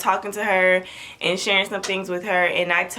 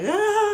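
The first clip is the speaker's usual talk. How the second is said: sudden high startled wail; frightened mother hearing bad news, high wail; high panicked cry; 13 semitones higher than usual. Crying after we need to talk.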